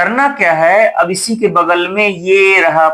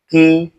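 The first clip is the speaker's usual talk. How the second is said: "कि" with the i held for two beats; doubled vowel length; 3 semitones lower than usual. Shown as a demonstration. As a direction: lengthen "कि" to twice its short length. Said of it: "इससे दो गुना ज्यादा time". ("कि" की ई दो गुना लंबी)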